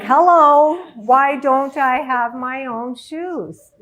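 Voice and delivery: loud "hello", then drawn-out question; exclaimed realization. Retold or imitated. Woman reacting to her mentor's question, loud and incredulous. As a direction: call it out like the obvious just struck.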